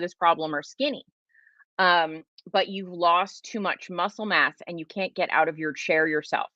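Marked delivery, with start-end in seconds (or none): none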